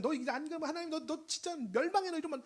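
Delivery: high-pitched, imitated pestering threat; mock doom warning. Pastor mimicking Noah chasing people with warnings, mock alarm. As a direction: parody a pushy, alarmist evangelist.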